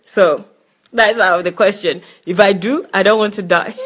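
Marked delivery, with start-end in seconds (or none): none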